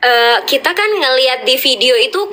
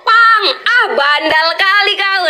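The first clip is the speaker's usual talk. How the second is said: drawn-out, higher playful scold; mock outburst; 7 semitones higher, slower than usual. Aisar answering a heckler, higher mock-scolding outburst. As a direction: blurt it, then stretch out the scolding. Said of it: "ada yang nyeletuk".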